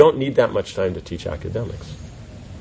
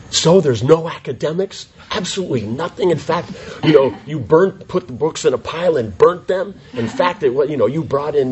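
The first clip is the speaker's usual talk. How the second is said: higher, half-laughing mock outrage; mock shock and horror; 6 semitones higher than usual. A parent imitated, asking about academics in mock horror. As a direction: play the horrified parent hearing there are no academics. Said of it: "with shock and horror".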